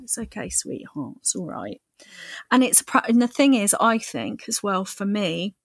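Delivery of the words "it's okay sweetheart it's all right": much softer and lower, soothing; soft reassuring murmur to yourself; tender soothing. Shown as a demonstration. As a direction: comfort yourself like a child. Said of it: "just go".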